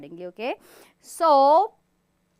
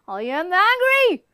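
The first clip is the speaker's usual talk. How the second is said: louder, higher, pointing anger; angry; 5 semitones higher, 7 dB louder than usual. Shown as a demonstration. Teacher showing the class an angry face, louder. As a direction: jab the words at the camera. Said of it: "and say".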